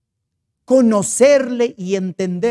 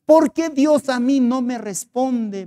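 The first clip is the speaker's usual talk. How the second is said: higher, drawn-out plaintive question; anguished complaint; 6 semitones higher, 3 dB louder than usual. Acted. Hotel owner asks the pastor why God ignores her, plaintive. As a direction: plead slowly for an answer, pitched up.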